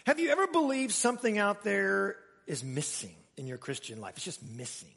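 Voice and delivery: normal talking voice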